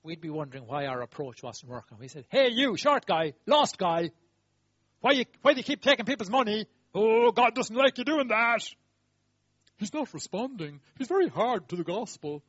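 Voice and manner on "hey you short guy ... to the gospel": loud, high self-righteous lecture; loud mock sermon at a sinner; mock preachy scolding. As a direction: hector him with the wrong approach. Preachy churchgoers mocked.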